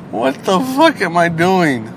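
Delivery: usual speaking voice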